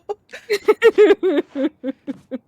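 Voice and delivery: loud, high duck quack; duck impression. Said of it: "It's my best impersonation of a duck".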